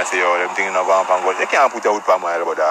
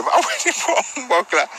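laughing outburst; 12 semitones higher, slower than usual. Real, not acted. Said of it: "comic outburst punchline".

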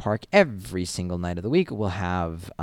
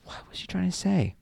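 whispered, baffled, pitched higher; inner whisper; 10 semitones higher, 3 dB quieter than usual. Retold or imitated. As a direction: whisper it to yourself in confusion. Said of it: "And I'm like".

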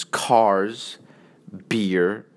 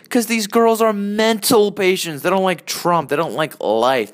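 worked-up rant; 8 semitones higher, 4 dB louder than usual. Real, not acted. Ranting about girls he dislikes.

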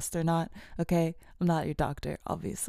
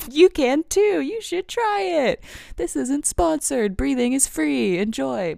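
high, loud over-the-top sales spiel; mock advert pitch; 9 semitones higher, 7 dB louder than usual. Podcaster selling free breathing to listeners, high and loud. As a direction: sing it out like a hammed-up infomercial.

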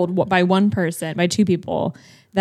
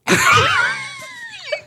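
real, very high squeal of laughter; shrieking laugh. Real, not acted. Unable to shut it off, shrieking laugh.

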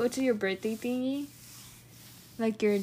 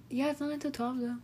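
hushed, softer aside; accidental near-whisper; 9 dB quieter, faster than usual. Real, not acted. Answering a friend about the time, accidentally near-whispering. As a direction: drop the volume without meaning to. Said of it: "What did I freaking whisper that?".

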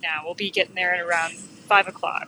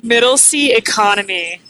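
loud emphatic; 4 semitones higher, 8 dB louder than usual. Real, not acted. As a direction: punch out Middle seat economy loudly. Joking about cheap flight seat.

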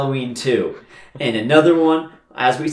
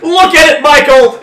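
high, very loud acted yell; mock shouting; 12 dB louder than usual. Podcasters confronting Michael with his mask, high mock shout. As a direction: holler it like a frantic demand.

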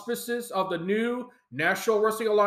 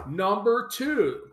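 ordinary talk

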